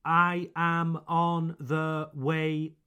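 slow, even, word-by-word robot delivery; robotic. Shown as a demonstration. Teacher showing listeners how not to say it, robotic. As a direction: say each word separately, like a robot. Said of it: "like a robot".

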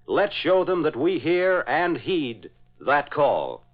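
ordinary talk, nothing marked

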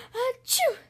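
loud acted sneeze mid-word; fake sneeze. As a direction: burst out with a big sneeze. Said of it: "stopped sneezing".

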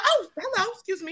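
airy, high fake-polite hello; mock polite greeting. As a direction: brush them off sweetly. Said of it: "Or I'll be like".

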